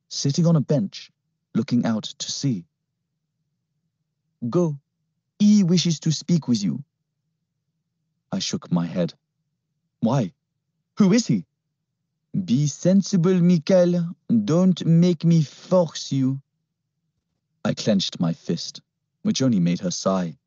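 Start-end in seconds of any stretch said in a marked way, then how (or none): 4.5-6.8 higher, cool French command; French-accented orders. Acted. Captor ordering the boy off the scooter, French accent. 10.0-11.5 higher, anxious questions; frightened questions. Acted. Boy asking the girl who the man is, uneasy. 12.5-16.4 higher, slow, cool French warning; French-accented threat. Acted. Captor warning the boy, slow French threat.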